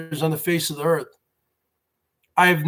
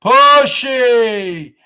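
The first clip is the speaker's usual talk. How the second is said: mock shout; 3 semitones higher, 6 dB louder than usual. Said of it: "pronounce pussy as pushy".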